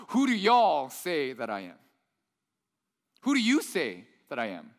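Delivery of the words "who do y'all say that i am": put-on slow, drawling Texas accent on Who do y'all say that I am; a preacher putting on a Texas accent for Jesus's question; Texas version. Preacher voicing Jesus's question to disciples in a Texas accent. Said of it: "the Texas version".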